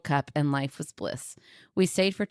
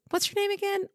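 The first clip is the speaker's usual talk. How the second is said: way higher, puzzled question; high-pitched barista voice; faster than usual. Retold or imitated. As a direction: squeak it like a confused barista. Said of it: "And she goes".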